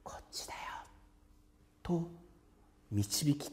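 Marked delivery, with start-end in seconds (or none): 0.0-0.6 whispered from right beside; whispering close like a guide. Shown as a demonstration. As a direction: murmur it gently close by. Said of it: "そば近くで".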